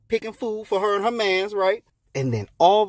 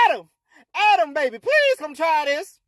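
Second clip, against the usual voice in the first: excited hollering; 8 semitones higher than usual. Acted. Eve calls Adam to try it, excited hollering. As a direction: holler for him, pleading. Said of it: "What you hollering for".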